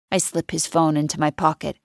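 usual speaking voice

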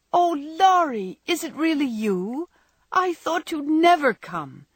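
high, loud, drawn-out exclamation of joy; delighted cry. A narrator's reading. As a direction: cry out in glad surprise at a long-awaited friend. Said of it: "cried Amy".

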